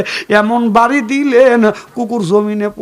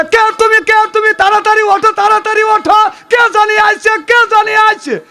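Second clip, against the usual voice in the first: dog shouting to wake its sleeping master; alarmed shouting; 12 semitones higher, faster than usual. Acted. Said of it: "বাড়িওয়ালা তোলে".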